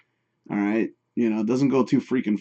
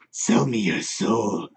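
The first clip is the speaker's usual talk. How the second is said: demon voice; 2 semitones lower, 3 dB quieter, much slower than usual. Pretend. Mock demon offer.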